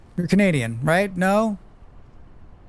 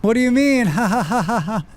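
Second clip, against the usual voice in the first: fake laughing; 3 semitones higher than usual. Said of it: "And I'm like".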